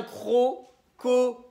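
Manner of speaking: « crocodile » très lent, découpé en syllabes; détache fort chaque syllabe de « crocodile »; syllabe par syllabe. Shown as a demonstration. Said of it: "on va frapper ses syllabes". (« crocodile » scandé syllabe par syllabe)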